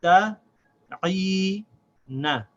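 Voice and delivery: syllable by syllable. Shown as a demonstration. Teacher splitting the word into its syllables. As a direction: break the word into parts. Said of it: "So this is pronounced".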